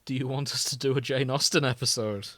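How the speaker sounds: ordinary delivery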